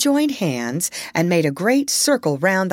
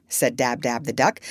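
ordinary delivery, nothing marked

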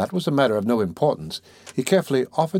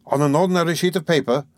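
higher-pitched, eager question; eager interruption; 7 semitones higher than usual. A narrator's reading. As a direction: jump in keenly with the question. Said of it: "interrupted eagerly".

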